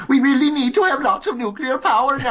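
high, hammed-up Swedish teen accent. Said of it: "let me remember my Swedish accent".

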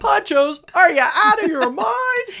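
high, loud, drawn-out mock disbelief; mock outraged outburst. Teasing Alex's violent reaction to ponchos, mock outrage. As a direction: blurt it out like a hammed-up knee-jerk overreaction. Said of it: "She goes straight to whatever pops her head".

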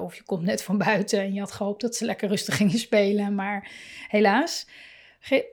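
ordinary talk, nothing marked

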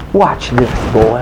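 low-pitched acted taunt; understated cocky aside; confident challenge. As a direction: say it low, like a prophet sure of himself.

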